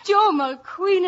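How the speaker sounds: loud, slow hail across the road; calling out. Acted. Irish girl hails Joe Mulqueen, loud and drawn out. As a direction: call his name out big and drawn out.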